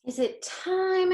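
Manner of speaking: put-on high, slow, hopeful kid's question; a child asking if it's finally time; eager little girl. Girl character voiced in story.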